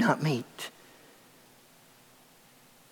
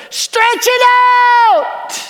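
high, loud coach's yell; the coach yells at the running kids to stretch it out; 8 dB louder, slower than usual. Retold or imitated. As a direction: bark the order across the field like a coach. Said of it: "he yells".

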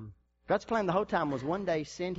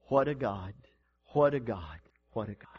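awed hush; 8 semitones lower than usual. Retold or imitated. Preacher voicing onlookers amazed at God, hushed. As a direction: let the awe sink lower each time. People marveling at changed life.

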